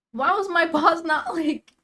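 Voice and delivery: high, loud frustrated question easing off; exasperated complaint with a laugh in it; real frustration. Real, not acted. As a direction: burst out in frustration, then settle. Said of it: "It still frustrates me so much".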